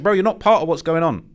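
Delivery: real irritated pushback; indignant rebuke. Real, not acted. Ranting back at the bystander.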